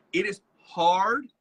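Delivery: slow, loud, stretched "it is hard"; drawn-out "hard" that rises at the end; emphatic. Real, not acted. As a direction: lean hard on "it is hard", slowly. Stressing the point to listeners.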